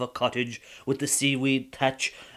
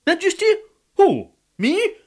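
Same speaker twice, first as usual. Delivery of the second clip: squeaking high disbelief, much louder; can't believe he's called Majesty; high loud "Me?" of a dumbfounded fisherman; astonished; 9 dB louder than usual. Acted. Colleen astonished at title.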